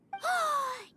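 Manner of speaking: sudden high gasp of realization; surprised gasp. Acted. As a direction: catch your breath as it clicks. Paimon realizes the sigil's power.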